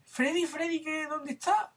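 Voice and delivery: high, anxious calling wife; lightly voiced panicked wife; mock distressed wife. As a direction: call for your husband in alarm. Wife arriving, retold comic.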